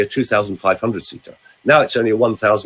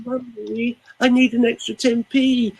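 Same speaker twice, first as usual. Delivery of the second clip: put-on wheedling child; 11 semitones higher than usual. Coach imitating a child asking mummy for money, high and wheedling. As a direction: beg mummy sweetly, higher. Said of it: "when a small child is stressed".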